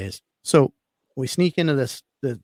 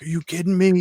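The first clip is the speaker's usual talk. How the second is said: imitating the guys, higher and louder in amazement; mock the squad's excited disbelief; retold disbelief; 8 semitones higher, 7 dB louder than usual. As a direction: squad seeing the whiskey, retold amazed exclamation. Guys first see the whiskey.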